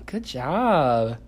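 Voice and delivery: loud sing-song praise. Real, not acted. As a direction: cheer the little one on. Parent praising toddler.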